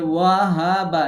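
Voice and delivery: slow, stretched-out ওয়াহাবা with long vowels; ওয়াহাবা stretched slowly, as a teacher shows the error; wrong drawn-out way. Shown as a demonstration. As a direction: drag out ওয়াহাবা to show the mistake. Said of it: "এরকম না".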